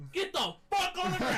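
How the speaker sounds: high, mock cop yell; shouted police order. Police ordering him down as they arrive, mock shouted order. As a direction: imitate a cop yelling a command.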